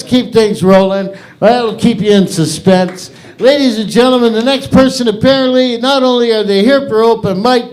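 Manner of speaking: loud, high host hype; emcee announcement. Acted. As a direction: bring the next comic up like an announcer. Hosts introducing the next comic.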